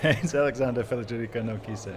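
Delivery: quick, low run through the full name; rapid, matter-of-fact; fast. Real, not acted. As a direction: rattle the whole name off. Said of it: "Say it slower".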